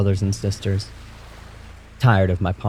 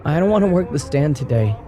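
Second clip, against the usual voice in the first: put-on higher girl's voice, sulking; sulky muttered complaint; 7 semitones higher than usual. A narrator's reading. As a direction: grumble it like a pouting daughter. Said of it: "I muttered".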